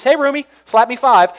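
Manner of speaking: joking frat-buddy voice; mock roomie greeting. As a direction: play the chummy roommate for a laugh. Mocking roommate couple greeting.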